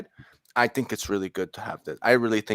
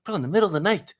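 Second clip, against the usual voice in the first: high, alarmed cousin voice; mock freaked-out retelling, pitched way up; imitating cousin; 9 semitones higher than usual. Cousin tells how he woke to voices, high and alarmed. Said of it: "he's like".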